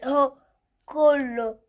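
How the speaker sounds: loud, insistent repeating; loud insisting. Real, not acted. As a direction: shout each word stubbornly. Child protests, repeats louder. (إصرار بصوت عالي)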